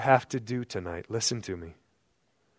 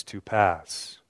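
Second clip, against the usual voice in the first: ordinary talk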